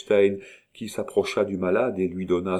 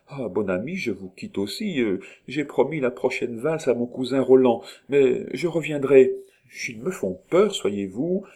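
higher-pitched, lightly voiced princess; false princess; 6 semitones higher, 3 dB louder than usual. A narrator's reading. False Nita taking leave of the sick man, higher-pitched princess. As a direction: excuse yourself sweetly, lifting the pitch. Story character's voice.

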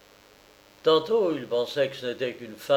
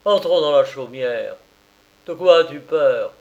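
louder, pressing lord; insistent nobleman; 7 dB louder than usual. A narrator's reading. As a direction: push her to come inside. Thaddée urges her inside.

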